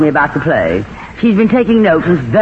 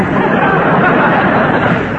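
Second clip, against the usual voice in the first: laughing; 9 semitones higher than usual. Acted. Tallulah laughs at Mindy.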